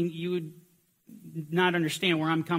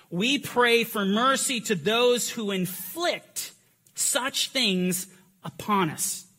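fervent reading; 4 semitones higher, slower than usual. Acted. Reading martyr's words with fervor.

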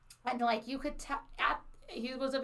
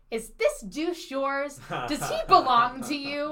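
loud, indignant demand, pitched up; enraged; 5 semitones higher, 8 dB louder than usual. Retold or imitated. Woman hauling a man back to his friends, loud outraged demand. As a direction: confront them loudly, outraged. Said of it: "I was blackout with rage".

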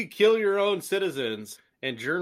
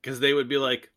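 ordinary talk, nothing marked

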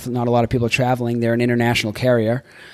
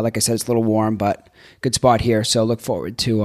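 usual speaking voice

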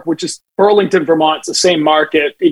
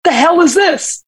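mock gruff question, higher and slow; annoyed manager; 10 semitones higher than usual. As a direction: demand it like a baffled, irritated manager. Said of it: "looks up at him and says".

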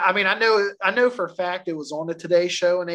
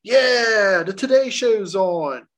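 loud, high cheer as the show starts; excited TV viewer; 3 semitones higher, 5 dB louder, slower than usual. Acted. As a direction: act out a thrilled viewer cheering. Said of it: "You're all excited".

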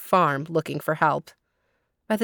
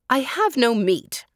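high-pitched, mildly cross retort; indignant storybook snap; snapped; 7 semitones higher than usual. A narrator's reading. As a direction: snap back a refusal. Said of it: "snapped Tante Adela".

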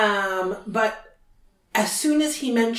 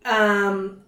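normal talking voice

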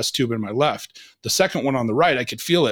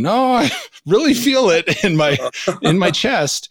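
laughing plea; 7 semitones higher, 4 dB louder, slower than usual. Retold or imitated. Retelling panicked plea to wife.

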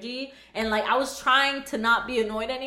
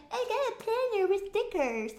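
smug friend mimic; 9 semitones higher, 6 dB quieter than usual. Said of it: "she's like".

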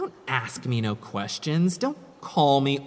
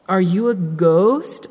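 louder, higher call into the dark; 5 semitones higher, 5 dB louder than usual. Acted. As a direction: call out to the ghost. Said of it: "I called".

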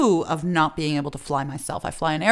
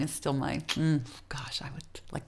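breathy dreamy whisper; 3 semitones lower, 6 dB quieter than usual. Real, not acted. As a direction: hush as if savoring it. Savoring the feeling of flying.

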